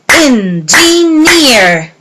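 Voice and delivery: slow, beat by beat: En-gi-neer; counted syllables. Shown as a demonstration. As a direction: spell out Engineer in three beats. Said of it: "Let's count them together".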